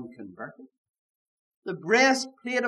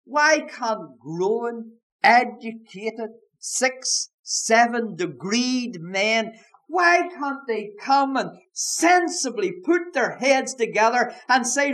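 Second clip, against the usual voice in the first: slow, mounting, louder and higher; rising preaching; 5 semitones higher, 5 dB louder, slower than usual. Real, not acted. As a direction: build the challenge word by word. Preacher's rising rhetorical challenge.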